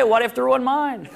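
usual speaking voice

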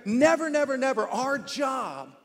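loud, high, driving sermon delivery; preaching at full force. Acted. Pastor drives the point home to congregation, preaching loudly. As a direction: preach it hard, pressing every line home.

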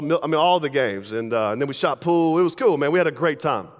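ordinary talk, nothing marked

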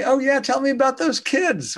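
high, gushing fake interest; fake friendly. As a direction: gush with phony warmth. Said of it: "It's all fake".

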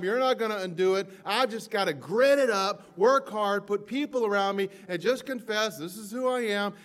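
hammed-up defeated declaration; preached mock confession. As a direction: throw the doubter's words out in full voice. Voicing doubt to God, preached.

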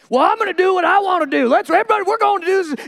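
high, loud, pushy take-charge voice; bossy friend. Acted. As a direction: overrule the whole group, hammed up. Bossy hate-and-truth friend takes over.

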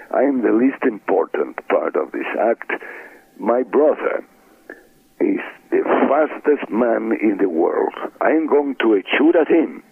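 put-on high-pitched Mexican accent, proudly announcing; hammed-up Mexican-accented stage introduction; Mexican character. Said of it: "my Mexican character".

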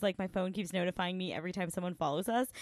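ordinary delivery

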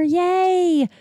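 high-pitched, happy little yay; celebrating the end of the year; celebratory cheer. Real, not acted. Celebrates the year's last episode.

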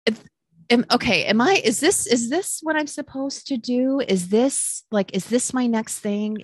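high, rushed, wondering if this is her calling; thinking aloud, high and unsure; flustered self-questioning. Retold or imitated. Telling the host how she wondered about matchmaking, high and unsure. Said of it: "I'm like".

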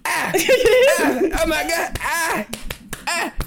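high cartoon-character cries of being slapped; South Park. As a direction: fake getting hit in a squeaky cartoon voice. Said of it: "You sound like a South Park character".

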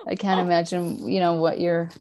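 ordinary talk

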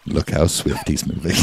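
slow, soft, low documentary narrator voice; nature documentary. Retold or imitated. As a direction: marvel drily like a wildlife narrator.

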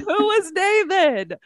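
laughing. Acted. Echoes question dramatically, laughing.

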